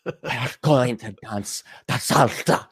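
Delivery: put-on slow, swooning Spanish accent on Esteban; dreamy tourist showing off her Spanish; put-on Spanish accent, lightly done; mock Spanish accent.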